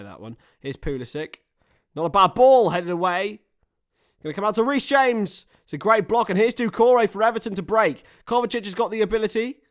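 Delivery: steady play-by-play jumping to loud, high excitement; excited match commentator; live football commentary. Pretend. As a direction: call the match, lifting sharply as the attack builds.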